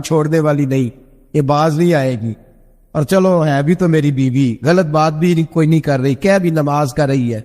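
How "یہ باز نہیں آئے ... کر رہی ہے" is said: ordinary delivery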